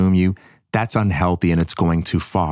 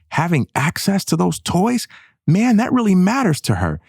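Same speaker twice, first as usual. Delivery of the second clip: normal talking voice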